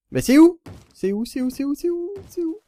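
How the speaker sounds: high, rising frantic repeats; lost and starting to panic; panicked searching. Real, not acted. Perdu, cherche sa base paniqué. (panique aiguë en cherchant sa base)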